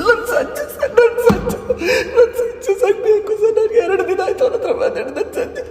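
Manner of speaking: desperate sobbing plea, breaking into cries. Acted. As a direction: beg through tears for her lost son. Crying mother at station. (ಬಿಕ್ಕಿ ಬಿಕ್ಕಿ ಅಳುತ್ತಾ ಬೇಡಿಕೆ)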